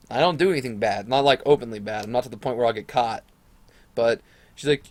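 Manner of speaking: ordinary delivery, nothing marked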